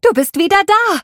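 high, loud delighted shout of a name; a young girl squealing with joy; joyful cry. Acted. Youngest daughter greets her returning brother, jubilant. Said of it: "Isabellas freudigem Jubelschrei".

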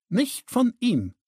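high, drawn-out indignant rejection; scornful refusal. A narrator's reading. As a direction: refuse it with open contempt. Daughter rejects the offered help.

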